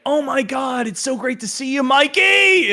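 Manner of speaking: high-pitched imitated gush, rising on the name; gushing greeting. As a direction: squeal like a fan greeting a long-missed friend. Imitates crowd greeting long-lost Mike.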